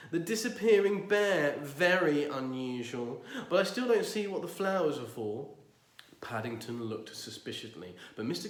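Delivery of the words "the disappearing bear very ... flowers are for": pompous neighbour. Acted.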